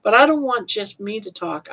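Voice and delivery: normal talking voice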